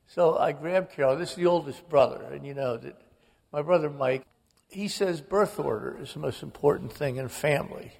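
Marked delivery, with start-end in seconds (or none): none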